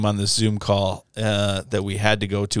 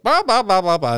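high, loud blah-blah imitation; exaggerated high-pitched babble; mock chatter; 10 semitones higher, 4 dB louder than usual. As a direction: mimic people yakking away, high and falling. Imitating others talking over the phone.

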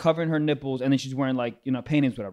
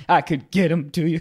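lightly mock a shady drug dealer; teasing copy of an odd delivery; mocking imitation. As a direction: mimic the weird phrasing. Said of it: "Why do you say it weird".

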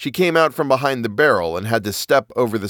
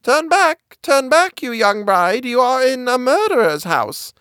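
high, ringing bird call; 13 semitones higher than usual. A narrator's reading. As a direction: sing out the warning like a caged bird. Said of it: "Suddenly, a voice called out".

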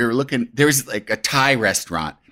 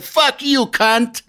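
fake Italian chef; 10 semitones higher, much slower than usual. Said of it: "I responded. I said".

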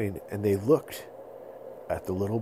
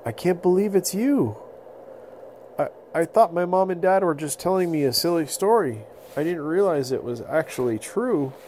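high, earnest storytelling voice; wondering mammoth; 8 semitones higher than usual. Acted. Mammoth telling the boy of the prophecy. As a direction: tell it with wonder.

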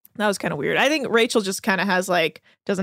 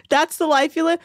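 usual speaking voice

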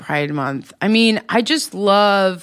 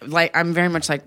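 ordinary talk

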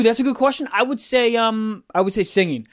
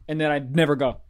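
normal talking voice

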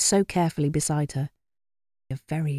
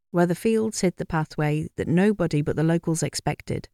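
ordinary delivery

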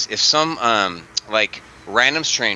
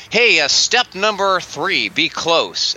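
higher, slow presenter parody; glib expert; 7 semitones higher, slower than usual. Retold or imitated. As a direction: toss out advice like a talking head. Mimics glib talking-head expert.

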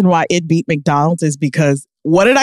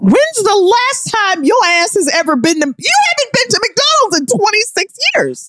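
high, indignant disbelief; outraged. Real, not acted. Outraged at her sister's McDonald's pick. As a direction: squeal your outrage at her.

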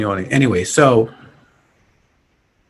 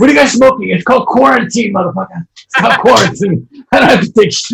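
higher, louder, indignant mock rant; mock defiant rant; 6 semitones higher, 4 dB louder, faster than usual. Friend acting out a character's comeback, indignant mock rant. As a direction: play the cocky guy snapping back. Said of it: "laughing quote".

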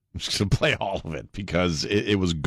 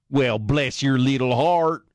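slow, higher mock Southern drawl; Southern accent; 6 semitones higher, slower than usual. Host mocking the woke crowd, slow Southern drawl. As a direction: pour on the syrupy Southern bless-your-heart. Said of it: "slow ... Southern accent".

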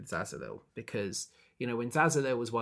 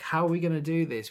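mock fretting, high and loud; acted dismay; worried question; 6 semitones higher, 7 dB louder than usual. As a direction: quote a helpless, flustered crowd. Acted group fretting over lost fire.

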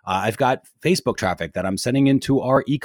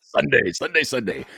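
monster truck ad; 3 semitones higher than usual. Pretend. Continues monster truck ad joke.

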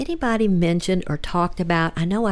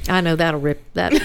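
ordinary talk, nothing marked

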